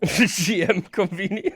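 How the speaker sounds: laughing out the words, high and loud; a real laugh running through the line. Real, not acted.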